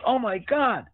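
high, excited "Oh, my God"; delighted recognition. Retold or imitated. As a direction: squeal it like you just spotted an old friend. Hockey star recognizes the director.

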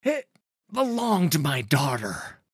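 high, loud, halting answer; hesitate, then blurt it out under threat; frightened protest. Acted. Farmer answers at knifepoint.

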